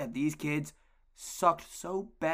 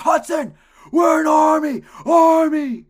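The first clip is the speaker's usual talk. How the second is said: loud, much higher shout across the field; coach's shout; 13 semitones higher, 17 dB louder, slower than usual. Retold or imitated. Coach shouting the play call at his player, high loud yell. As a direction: roar the kid's name and the call.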